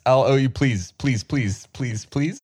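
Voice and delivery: pleading. Acted. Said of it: "I run up to Claire".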